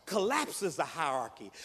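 ordinary talk, nothing marked

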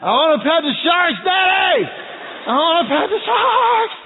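put-on high, loud, thrilled child voice; excited kid shout. Cody begging his dad to pet sharks, thrilled high shout. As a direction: yell it like a kid who loves sharks. Fearless son pressed against glass.

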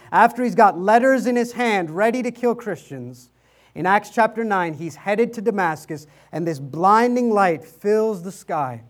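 ordinary delivery

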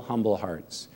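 ordinary talk

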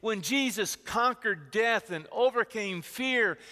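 full-voiced, rising sermon delivery; preaching. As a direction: preach it with conviction.

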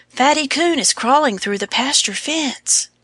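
high, frightened squeak; terrified squeak. A narrator's reading. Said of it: "squeaked in a frightened voice".

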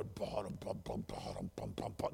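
breathy whispered ba-dum bum; whispered Jaws theme. Shown as a demonstration. Retelling the diver scene, whispering the Jaws theme. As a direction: whisper the shark music low. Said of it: "The music is pounding".

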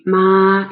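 ma drawn out on a level pitch; flat first tone. Shown as a demonstration. Teacher introducing the word for mother, flat tone. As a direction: hold ma steady without any rise or drop. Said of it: "ma is a flat tone".

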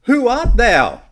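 high, loud demand; loud challenging question. Retold or imitated. Pharisees confronting John the Baptist, loud and demanding. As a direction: fire the question at him.

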